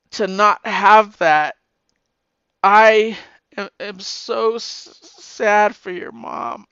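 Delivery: struggling to get the words out; tearful. Real, not acted. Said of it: "I'm sorry I got emotional".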